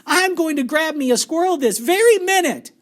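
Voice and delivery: high, loud bratty threat; defiant shout. A narrator's reading. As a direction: yell it like a spoilt brat. Spoilt Veruca defiant.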